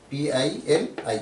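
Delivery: slow letter-by-letter spelling. Shown as a demonstration.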